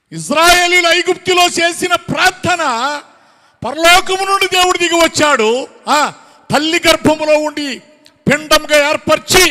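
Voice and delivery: high, loud sermon delivery; full-force preaching. Real, not acted. Preacher tells the congregation how God came down, full-force preaching. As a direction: proclaim it in ringing, sustained phrases.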